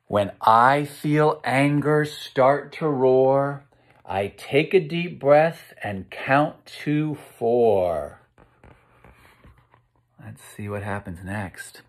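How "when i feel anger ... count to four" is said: put-on reading to children. Teacher reading a rhyming book to kids, sing-song. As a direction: read the rhyme out to the class with a bounce.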